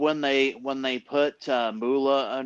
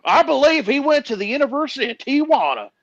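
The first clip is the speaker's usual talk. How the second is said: mock voice; 10 semitones higher, faster than usual. Mocking joke voice.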